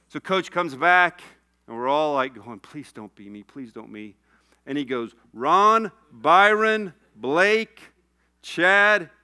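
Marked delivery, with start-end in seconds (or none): 2.6-4.2 low, soft mock plea; quiet, low begging aside; hushed pleading. Players' silent plea, retold. 5.3-9.1 loud, raised roll call; higher, punchy name-by-name call-out. Retold or imitated. Coach calls out names, retold.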